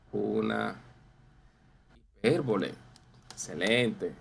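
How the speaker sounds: usual speaking voice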